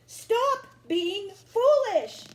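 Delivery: high, loud scolding; a hammed-up stern mother. A narrator's reading. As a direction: scold the boy as mama. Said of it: "said Mama".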